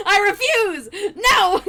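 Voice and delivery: high, loud refusal; defiant shout. Real, not acted. Killer shouting at escaping survivor. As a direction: yell it like a tantrum.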